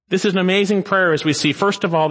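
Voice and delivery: normal talking voice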